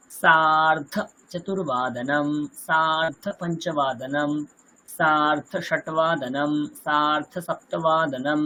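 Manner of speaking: slow, even chant of each term; slow recitation. Shown as a demonstration. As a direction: read the list slowly, one term at a time. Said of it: "বাদ বাকিগুলো ঠিক এরকমই হয়ে যাবে". (ধীরে ধীরে আবৃত্তি)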